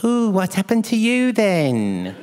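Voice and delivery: high, slow cooing nurse voice; hammed-up female nurse, sing-song concern; imitated set nurse. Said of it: "And she goes".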